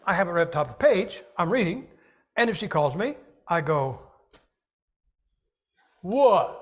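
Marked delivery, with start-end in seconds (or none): 6.0-6.5 calling back. Acted. Acts answering wife's call mid-reading.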